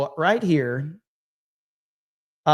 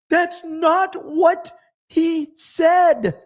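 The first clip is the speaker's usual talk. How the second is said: high, slow and louder, drawn out in disbelief; pitched way up, drawing out every word in disbelief; exasperated; 14 semitones higher, 4 dB louder, much slower than usual. Real, not acted. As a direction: stretch it out, incredulous and loud. Exasperated rebuttal of her tweet.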